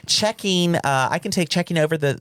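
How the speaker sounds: put-on high, sweet Southern accent; nice Southern lady. Bill collector asking for payment by phone, nice Southern-accented lady. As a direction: mimic a polite Southern caller, pitched well up. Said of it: "really nice lady".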